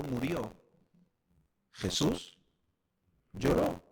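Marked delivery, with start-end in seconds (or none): none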